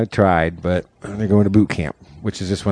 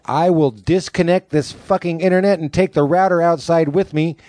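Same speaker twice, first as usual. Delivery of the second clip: raised, stern mom threat; threatening mom; 8 semitones higher than usual. Retold or imitated. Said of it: "she's like".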